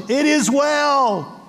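"It is well" slow and lifted, each word weighed; serene insistence. Retold or imitated. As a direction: say "It is well" slowly, calm and firm. Shunammite woman's steady faith.

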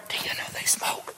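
hushed gossip whisper; whispered tattling about the sinners. Retold or imitated. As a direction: lean in and whisper it like church gossip. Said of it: "they'd walk up".

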